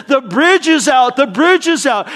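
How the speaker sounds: high, loud urgent shout of warning; yelling warning. Retold or imitated. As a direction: call out the danger, loud and high. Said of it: "God's been yelling at you".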